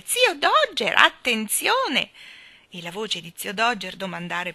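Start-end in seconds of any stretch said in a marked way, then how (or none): 0.0-2.1 high, loud warning cry; shrill shout. A narrator's reading. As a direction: shout it high, stretching the last word. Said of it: "voce acuta di Nelly Rubina gridare". (grido acuto di avvertimento)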